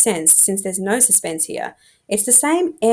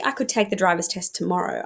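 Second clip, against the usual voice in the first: ordinary delivery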